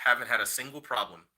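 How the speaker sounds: ordinary talk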